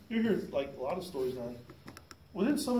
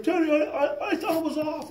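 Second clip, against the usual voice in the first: high, stammering coworker voice; flustered excuse; 9 semitones higher than usual. Retold or imitated. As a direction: stammer an excuse to an angry workmate.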